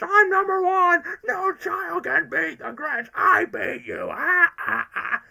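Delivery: slow, high, shouted gloating; Grinch. Retold or imitated. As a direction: crow it high and loud, stretching the words. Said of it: "Like in the Grinch".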